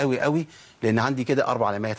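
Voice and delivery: normal talking voice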